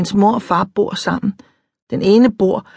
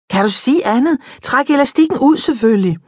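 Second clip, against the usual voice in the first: higher, teasing impatient; mild impatience; 7 semitones higher than usual. A narrator's reading. Impatient girl.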